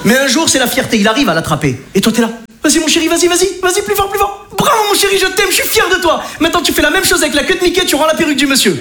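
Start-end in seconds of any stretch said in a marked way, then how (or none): 2.6-8.8 high, over-the-top proud father cheers; hammed-up Italian-free stadium cheering for a merry-go-round; excited cheering dad.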